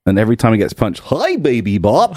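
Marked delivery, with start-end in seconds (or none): 1.1-2.1 slow, goofy purple dinosaur voice; Barney impression. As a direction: draw out a cheery kids' TV dinosaur greeting. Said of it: "That's a really good Barney impression".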